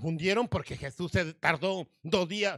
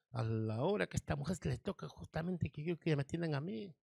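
low, softer, bitter muttered complaint; frustrated father; 4 semitones lower, 11 dB quieter than usual. Acted. Desperate father complaining that the sick woman delays Jesus, softer and bitter. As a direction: grumble it under the breath like a desperate father.

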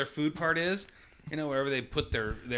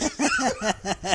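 squealing laugh; high laugh; 10 semitones higher, 4 dB louder than usual. Real, not acted. Laughing at fart joke.

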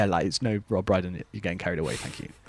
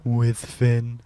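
announcer tag; 3 dB louder than usual. Acted. Jingle tag line.

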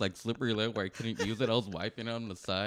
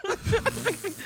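high laughing burst, slowed; 4 dB louder than usual. Real, not acted. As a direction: breaks into laughter. Laughs before the quote.

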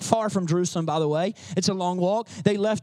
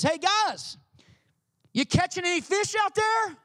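higher, friendly call across the water; Jesus calling out; 8 semitones higher than usual. Retold or imitated. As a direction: call out to the fishermen from the shore. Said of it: "he yells out to them".

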